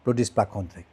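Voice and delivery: ordinary talk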